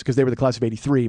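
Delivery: ordinary delivery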